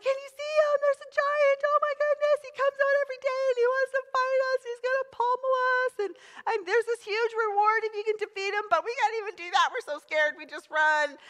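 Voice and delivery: high, fast, panicky babble; frightened soldiers chattering in a high panic; scared Israelite soldiers. Retold or imitated. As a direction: gush out the soldiers' fright in a squeaky rush. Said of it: "They're like".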